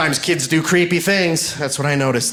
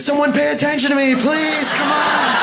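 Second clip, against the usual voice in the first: put-on needy middle child; 11 semitones higher than usual. Middle child begging anyone to notice him, high pleading whine. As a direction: beg for attention like a neglected kid.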